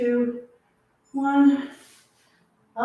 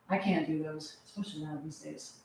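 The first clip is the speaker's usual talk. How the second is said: muttered under the breath, much softer; quiet aside; 8 semitones lower, 12 dB quieter than usual. Real, not acted. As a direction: drop the voice for a private admission. Quiet aside about own limits.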